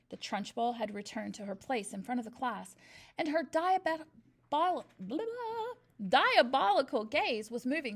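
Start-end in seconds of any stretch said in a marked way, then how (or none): none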